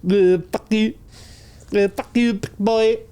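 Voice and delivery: a light put-on Vietnamese accent. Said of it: "trying to learn how to do the Vietnamese accent".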